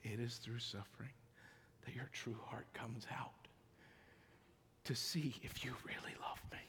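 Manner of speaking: whispered, tender answer; gentle voice of God. Acted. God answering the grieving believer, whispered and gentle. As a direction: answer softly like a loving father. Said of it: "And God says".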